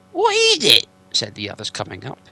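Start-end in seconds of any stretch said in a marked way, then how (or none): 0.1-0.9 much higher, louder troll voice; trolls asking. Acted. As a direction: shout the question like a puzzled troll. The other trolls ask.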